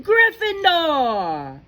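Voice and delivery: drawn-out high, loud announcement; hum, then cry out the house slowly; shouting hat. Acted. The Sorting Hat announces Hannah's house, shouting slowly. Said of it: "When it finally shouted".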